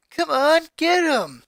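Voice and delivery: high, eager cheer at the TV; root for the cops on screen; boy cheering a TV car chase; excited cheering. Acted.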